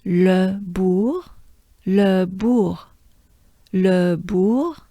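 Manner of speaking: "Le bourg" with a throaty French R; "le bourg", rounded ou, breathed R; French pronunciation. Shown as a demonstration. Teacher drilling "Le bourg" with learners. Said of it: "as I said".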